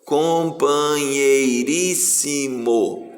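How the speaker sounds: "Companheiríssimo" very slowly, every syllable stretched. Shown as a demonstration. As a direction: sound it out slowly for a pupil. Teacher sounds out syllables for pupil. (sílaba por sílaba, bem devagar)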